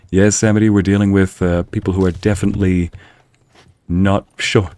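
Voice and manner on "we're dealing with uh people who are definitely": usual speaking voice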